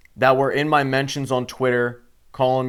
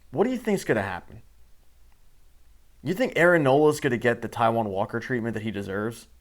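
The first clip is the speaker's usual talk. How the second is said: ordinary delivery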